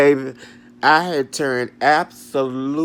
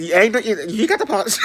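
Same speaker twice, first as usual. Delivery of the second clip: mock outrage; 9 semitones higher, 4 dB louder, faster than usual. Retold argument.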